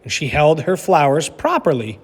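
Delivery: lively storybook narration for a class; reading aloud to children. A narrator's reading. Teacher reading a picture book to her class, animated storytelling. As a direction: read it like story time for kids.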